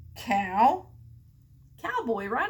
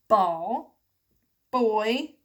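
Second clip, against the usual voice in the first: usual speaking voice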